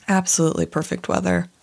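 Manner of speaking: ordinary talk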